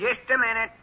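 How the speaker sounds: pitched well up to fool the caller; old man faking a high voice on the phone; high disguise voice. Acted. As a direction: put on a high voice to disguise yourself. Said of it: "I'll use my high voice".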